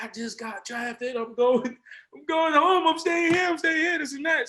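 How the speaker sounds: just drafted, yelling the news out of the car; elated shouting. Retold or imitated. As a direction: shout it down the street, overjoyed. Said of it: "immediately start yelling".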